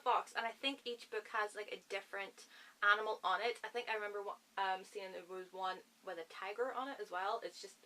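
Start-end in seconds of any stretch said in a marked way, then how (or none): none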